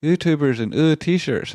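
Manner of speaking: high, scornful mimic of critics; sneering impression of critics; mocking whiny quote. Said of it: "they'll say things like".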